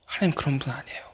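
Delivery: very soft, near-whispered reassurance; hushed assurance. Real, not acted. Preacher assuring the youths about God, hushed voice. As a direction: drop to a hush and reassure them. Gentle repeat after the shouts.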